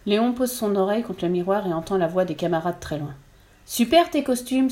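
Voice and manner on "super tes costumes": exclaiming. A narrator's reading. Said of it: "s'exclame quelqu'un".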